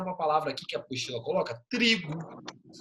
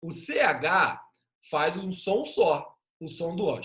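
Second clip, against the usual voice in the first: usual speaking voice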